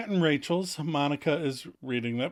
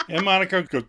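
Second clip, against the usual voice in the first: normal talking voice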